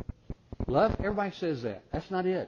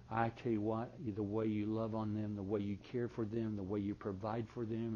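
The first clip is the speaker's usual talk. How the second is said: tender, sincere; 5 semitones lower, 11 dB quieter than usual. Shown as a demonstration. Husband telling his wife why he loves her, softly and tenderly. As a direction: speak it gently, meaning every word. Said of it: "which is better, him saying".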